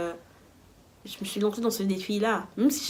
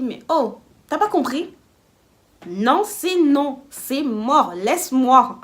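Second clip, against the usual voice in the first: loud, raised, drawn-out no; exasperated refusal; 4 semitones higher, 8 dB louder, much slower than usual. Retold or imitated. Refusing Franck who keeps insisting, exasperated refusal. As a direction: scold him hard, dragging the words.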